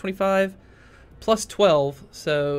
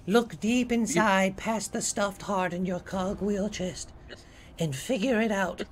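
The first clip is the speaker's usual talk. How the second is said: mystic oracle voice; 3 semitones higher, 5 dB quieter than usual. Acted. GM answers in a mystic tone.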